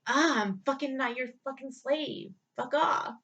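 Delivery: furious reenacted retort; angry retort. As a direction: bark it back at him. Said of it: "That's how I felt".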